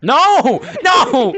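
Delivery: high loud cries of no; loud protest. Real, not acted. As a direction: shout it in protest. Protesting the card played.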